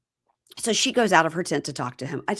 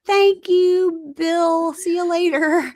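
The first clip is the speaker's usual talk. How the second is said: high airy mocking girl voice; mock her excuse, high and breezy; mock ditzy girl; 9 semitones higher, 3 dB louder than usual. Friend parodies the cast member's flimsy excuse, high mocking voice.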